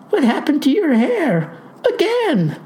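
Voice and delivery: high-pitched, hammed-up alarm; hollering. A narrator's reading. Clerk demands to know about farmer's hair, hollering. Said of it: "hollers she".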